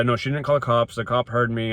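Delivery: normal talking voice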